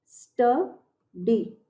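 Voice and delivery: "स्टडी" drawn out very slowly, syllable by syllable; careful pronunciation. Shown as a demonstration. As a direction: stretch "स्टडी" slowly so every sound is heard. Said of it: "उच्चारण ध्यान से समझिए". ("स्टडी" बहुत धीरे, एक-एक अक्षर खींचकर)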